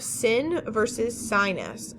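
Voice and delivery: sin with a short I, sinus with a long I, slowly. Shown as a demonstration. Teacher contrasting vowel sounds for students, slow. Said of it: "So sin is the I sound".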